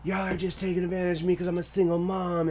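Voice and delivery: yelling mom imitation. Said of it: "she's screaming at me".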